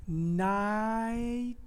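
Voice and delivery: नाइटिंगेल drawn out as naaai-tinnng-gel; slow teaching model of नाइटिंगेल; syllable by syllable. Shown as a demonstration. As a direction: stretch each syllable of नाइटिंगेल on a rising pitch. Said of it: "Let's come to this word".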